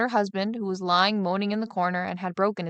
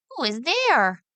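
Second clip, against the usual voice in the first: higher call into the dark tower; 6 semitones higher, 3 dB louder, slower than usual. Acted. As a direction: call out to the figure. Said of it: "cried he".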